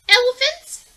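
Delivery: grumpy mole sneering at her plans; mock disbelief. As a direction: throw it out as a ridiculous guess. Mole mocks penguin idea.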